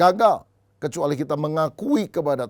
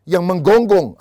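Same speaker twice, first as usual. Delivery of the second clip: louder, punched "menggonggong"; punchline bark; 4 semitones higher, 10 dB louder, slower than usual. Retold or imitated. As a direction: hit "menggonggong" loud as the joke lands. Punchline of the barking joke. ("menggonggong" diucapkan keras sebagai klimaks lelucon)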